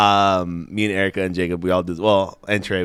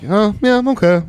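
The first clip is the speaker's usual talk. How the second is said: put-on high babyish goofy voice; imitated impediment, pitched way up; silly voice slipping out; 10 semitones higher, 3 dB louder than usual. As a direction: let a cartoonish voice slip out. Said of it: "Sometimes I let it slip".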